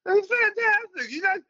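put-on high, slurred, drunk on-air gushing; drunk self on air. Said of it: "By the time we do the interview, I'm smashed".

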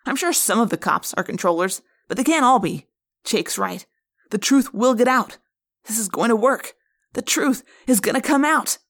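put-on teen friend getting swept up in hope; excited hope. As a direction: talk yourself into believing it. Marco sees the plan working.

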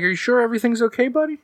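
fast, high worried mother; high mom voice. Acted. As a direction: rush it like a concerned mom. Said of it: "she's like".

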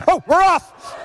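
bad race walker impression. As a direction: blurt it like a silly walker setting off. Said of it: "That is the worst impression".

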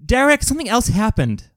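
high, loud mock-incredulous protest; mock disbelief. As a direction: plead loudly in a high voice.

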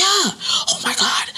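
whispered realization. Real, not acted.

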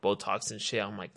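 normal talking voice

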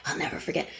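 breathy whispered aside, nearly silent. Real, not acted. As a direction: drop to a whisper for the irony. Ironic aside about forgetting.

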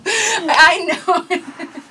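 high, loud burst breaking into laughter; helpless laugh on the words. Real, not acted. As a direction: say it through a laughing fit. Shared laughing fit while explaining.

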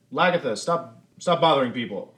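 mother scolding her daughter from the doorway; calling out. Acted. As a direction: call the kid off from a distance.

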